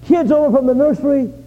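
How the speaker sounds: rising to a joyful shout; excited announcement. Retold or imitated. Pastor retelling his plan to the church, excited shouting. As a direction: pastor proclaiming a glorious march, building to a shout. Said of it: "and so I thought".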